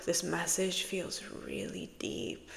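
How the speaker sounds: hushed whisper; soft whispered channeling. Real, not acted. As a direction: breathe it like a secret.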